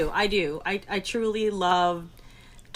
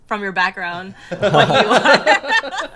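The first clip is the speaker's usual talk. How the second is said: laughing; 7 dB louder, slower than usual. Real, not acted. Teasing laugh about guest's background.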